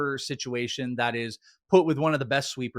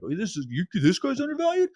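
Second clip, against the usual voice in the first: high-pitched mimicry of an arguer; mock incredulity; 9 semitones higher than usual. Mimicking people who argue about everything, in mock incredulity. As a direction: put on a voice shooting up in disbelief.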